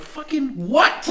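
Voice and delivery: high, slow exasperated question. Retold or imitated. Man retelling snapping at his cat, slow and high. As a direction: drag it out, fed up. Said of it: "I'm like".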